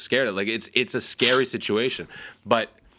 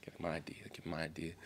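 nervous murmur; 4 semitones lower, 18 dB quieter than usual. Retold or imitated.

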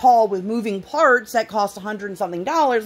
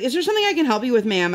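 rushed employee; 6 semitones higher, much faster than usual. Retold or imitated. Annoyed employee voiced.

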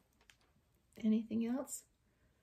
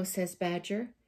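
usual speaking voice

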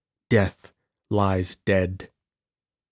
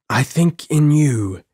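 "I think in you" distinct, higher and louder; said distinctly; 6 semitones higher, 5 dB louder than usual. A narrator's reading. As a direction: pronounce "I think in you" clearly, pitched up. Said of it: "he said distinctly".

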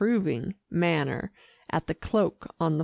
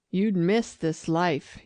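plain reading voice

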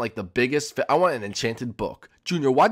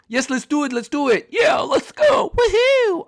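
excited cheer; 6 dB louder, slower than usual. Acted. Players cheering at start of game.

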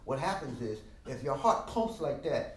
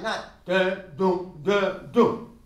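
louder, hammered dang boom; hard dang; 3 semitones higher, 9 dB louder, much slower than usual. Shown as a demonstration. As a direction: bang it out stiffly, the wrong way. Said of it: "Be careful of that".

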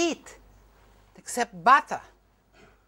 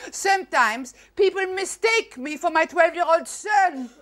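ordinary talk, nothing marked

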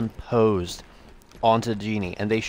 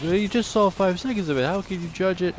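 high whiny objecting voice; high-pitched mock skeptic; imitating a viewer; 8 semitones higher than usual. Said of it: "I know you're like".

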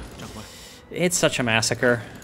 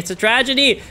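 mock lament; 10 semitones higher than usual. Real, not acted. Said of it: "This is just a disaster".

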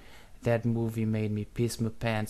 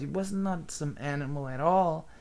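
usual speaking voice

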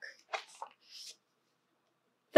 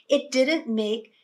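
ordinary delivery

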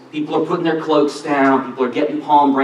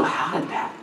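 awed whisper; 10 dB quieter than usual. Retold or imitated. Imagining his own amazed reaction.

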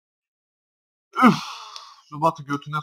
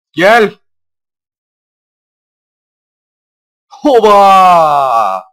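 loud, high triumphant cry; triumphant shout; 6 semitones higher, 19 dB louder, much slower than usual. Real, not acted. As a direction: celebrate the catch out loud. Catching moment exclamation. (zafer çığlığı)

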